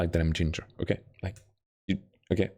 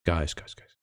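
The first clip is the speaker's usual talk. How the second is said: fast, lower whisper; 7 semitones lower, 5 dB quieter, much faster than usual. Acted. Streamer whispering a secret plan to chat, fast and hushed. Repeats line conspiratorially.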